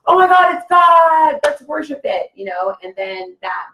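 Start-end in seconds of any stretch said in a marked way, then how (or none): 0.0-2.3 high, loud mock gasp of awe; hammed-up wonder at a false god; awed worship. Mocking humans awed by fallen beings.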